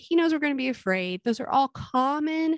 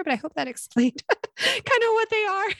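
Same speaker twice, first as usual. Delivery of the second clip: laughing; 11 semitones higher than usual. Real, not acted. Laughing at going off track.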